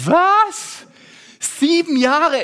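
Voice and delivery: high, loud, stretched Was; shocked disbelief. Retold or imitated. Teen hearing seven years, stunned and loud. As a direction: gasp out the shock. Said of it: "Und ich dachte".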